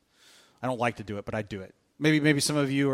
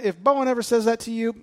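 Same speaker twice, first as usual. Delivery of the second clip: usual speaking voice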